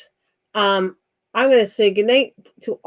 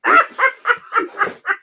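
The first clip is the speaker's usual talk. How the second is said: a burst of high squealing laughter; real giggle, pitched high. Real, not acted. High laugh after teasing.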